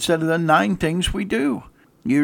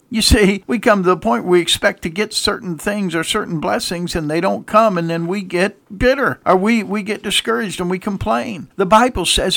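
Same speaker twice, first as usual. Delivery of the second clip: ordinary delivery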